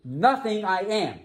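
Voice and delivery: "Nothing" stressed high and first, falling after; emphatic. Shown as a demonstration. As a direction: punch "Nothing" before "I am". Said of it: "You can't be more emphatic than that".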